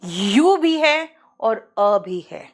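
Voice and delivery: यू and अ stretched out slowly; slowly name both sounds; slow teacher explanation; slow demo. Shown as a demonstration. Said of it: "pronunciation दो है".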